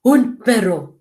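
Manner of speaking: dog-like woof on each word. Shown as a demonstration. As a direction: bark it like a dog. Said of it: "trying to woof like a dog".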